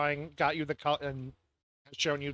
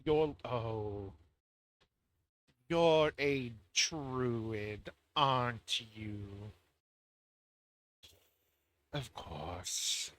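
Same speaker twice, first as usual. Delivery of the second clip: slow, disdainful drawl; condescending; much slower than usual. Acted. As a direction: look down on the druid. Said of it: "Why she gotta say it like that".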